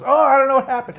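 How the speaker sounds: high, loud feigned innocence; hammed-up fake surprise; mock innocent. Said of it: "and he goes".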